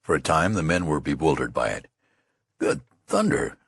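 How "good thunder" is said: slow, high "Good thunder!" of shock; startled exclamation. Acted. Soldiers stunned by the general: startled exclamation. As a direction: blurt it out, stunned. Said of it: "they ejaculated".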